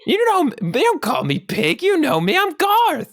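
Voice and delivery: high character voice, rising phrase ends; matey little pig, protesting. Acted. Garth corrects friends who call him pig, protesting pig voice.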